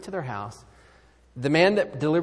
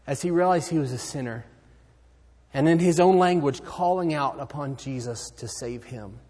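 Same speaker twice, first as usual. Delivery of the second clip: normal talking voice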